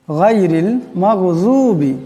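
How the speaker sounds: গায়রিল মাগযুবি slow, Ghain heavy and from the throat; slow careful Quranic গায়রিল মাগযুবি. Shown as a demonstration. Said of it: "এখানে গানকে অল্প মোটা আওয়াজে পড়তে হবে".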